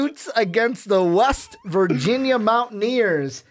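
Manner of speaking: high, slow drawn-out announcer call; big game-show style introduction; matchup announcer. Acted. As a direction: stretch out the team names like an announcer.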